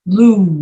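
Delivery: loud, drawn-out lose ending on Z; voiced Z. Shown as a demonstration. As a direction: lose with a long buzzing Z. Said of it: "S E is pronounced like Z E".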